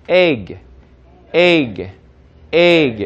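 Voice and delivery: "egg" with a clipped, closed g; closed egg ending. Shown as a demonstration. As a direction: close off the end of "egg" firmly. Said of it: "뒤를 갖다가요 오버하면은".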